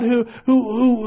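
ordinary delivery

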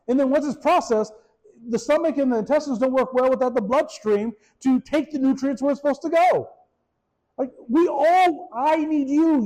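fired-up sermon delivery; preaching at full force. Acted. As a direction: raise the energy and preach it. Preaching build, higher and louder.

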